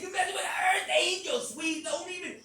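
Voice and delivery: high, loud indignant shout; retold warning. Speaker warning Sweet off, high indignant shout.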